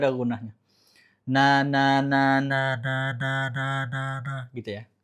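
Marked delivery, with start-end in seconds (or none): 1.3-4.5 na na with the nose pinched, sound muffled. Shown as a demonstration. Teacher testing nun gunnah with pinched nose. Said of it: "Ketika dipencet, suaranya berubah".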